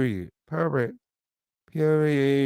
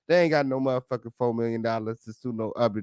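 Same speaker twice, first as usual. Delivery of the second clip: ordinary delivery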